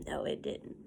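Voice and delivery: soft whispered no. Real, not acted. Teacher whispering the answer to her class.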